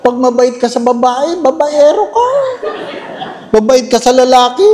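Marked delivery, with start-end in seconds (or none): 1.4-2.3 higher sing-song jab; mock accuser. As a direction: mimic the gossip's jab.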